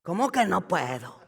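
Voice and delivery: a light Chihuahua norteño twang on the question; northern Mexican accent. Shown as a demonstration. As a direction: say it like a rancher from Chihuahua, teasing. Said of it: "con acento norteño de Chihuahua".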